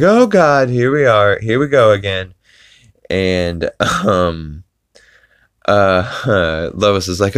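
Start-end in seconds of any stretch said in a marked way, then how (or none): 0.0-2.3 loud, high wail easing downward; mock exasperated cry. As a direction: imitate a man groaning at his own mishap. Voicing Peter stuck after falling through stairs.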